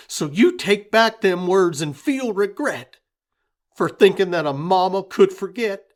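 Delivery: hurt fury. A narrator's reading. Grieving mother scolding her husband, loud and wounded. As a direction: make him feel the grief.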